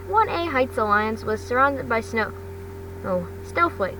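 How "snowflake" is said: plain reading voice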